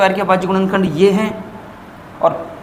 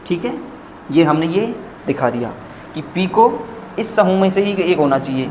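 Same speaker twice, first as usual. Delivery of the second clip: ordinary talk, nothing marked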